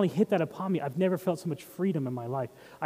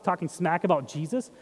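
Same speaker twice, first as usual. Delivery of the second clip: usual speaking voice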